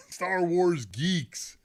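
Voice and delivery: ordinary talk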